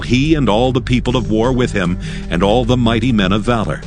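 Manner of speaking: normal talking voice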